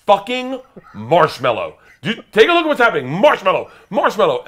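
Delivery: loud, high plea to the trainer; exasperated yelling. Retold or imitated. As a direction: yell the safe word in frantic disbelief. Yelling safe word at the trainer.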